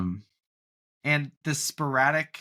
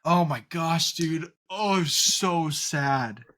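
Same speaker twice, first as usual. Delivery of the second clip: higher, drawn-out sympathetic groan of words; heartfelt, stretched-out "so"; moved lament; 4 semitones higher, much slower than usual. Real, not acted. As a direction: dwell on it, aching for the character. Moved by remembering a sad scene.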